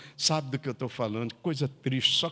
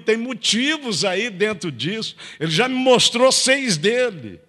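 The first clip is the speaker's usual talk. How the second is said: higher, louder fervent preaching; preaching at full force; 5 semitones higher, 6 dB louder than usual. Acted. Pastor urging the church to pray, fervent full-force preaching. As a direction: drive it home to the congregation.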